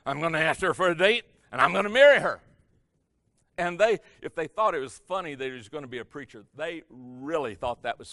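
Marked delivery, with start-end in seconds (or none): 0.1-2.4 louder, higher insistent repeat; louder boast. Retold or imitated. Joe repeating his boast, louder and more insistent. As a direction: push it out even harder the second time.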